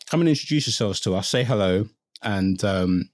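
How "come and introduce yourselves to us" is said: laughing. Real, not acted. Cracking up mid-sentence.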